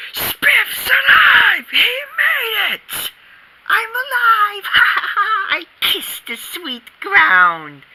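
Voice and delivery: high shouted joy with a laugh; ecstatic relief. Acted. Space hero cheers on landing alive, high and laughing.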